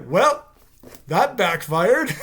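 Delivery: high then slow dry line; flat ironic comment; deadpan. Retold or imitated. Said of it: "and then just like".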